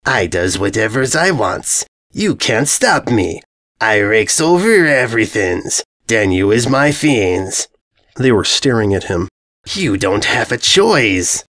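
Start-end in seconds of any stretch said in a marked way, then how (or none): 0.1-7.7 slow, dopey boastful brute voice; a thick-headed villain bragging slowly; dim bully invader. Acted. Invader boasting to the ponies, dopey and slow. 9.7-11.4 slow, lightly voiced brute; a dim villain laying down the law; dopey invader declaring. Invader declaring to the ponies, slow and dopey. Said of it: "the invader declared".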